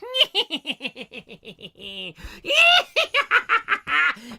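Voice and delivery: high, loud, long villain cackle; hammed-up evil laugh. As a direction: cackle wildly, rising to a shriek. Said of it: "The cackle".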